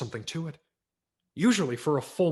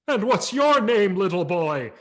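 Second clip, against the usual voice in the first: loud, higher, slow jolly Santa voice; booming Santa; 8 semitones higher, 11 dB louder than usual. A narrator's reading. Santa asking the boy his name, booming. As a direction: boom the question over the music. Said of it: "His booming baritone crashed out".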